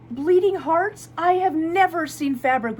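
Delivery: showing a favorite fabric, amazed; delighted. Real, not acted. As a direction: gush over the find.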